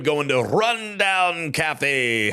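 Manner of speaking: normal talking voice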